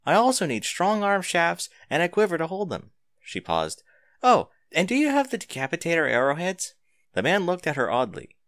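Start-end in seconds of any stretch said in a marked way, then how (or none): 0.0-2.9 a hint of a brisk young customer, pitched up; light girl voice, eager shopping list; lightly voiced Arya. Girl buying bow, voiced. 4.2-6.7 a hint of a brisk young customer, pitched up; light girl voice, eager shopping list; lightly voiced Arya.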